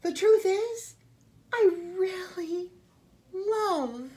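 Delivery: high, loud, pleading outburst; desperate confession. A narrator's reading. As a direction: blurt the truth at last. Camilla blurts her confession.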